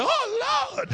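high-pitched swooning wail; hammed-up fainting fan; mock starstruck swoon. Preacher mocks church men fainting over a star, high mock wail.

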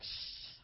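long unvoiced shh sound; imitated spray hiss. Dad takes the extinguisher from her hand, imitated spraying shh. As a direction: mimic an extinguisher going off. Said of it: "he's like".

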